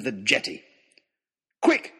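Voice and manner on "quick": high, loud cry of command; urgent shout. A narrator's reading. Narrator rousing the crew, urgent shout. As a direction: shout it sharply to rouse everyone. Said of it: "I shouted".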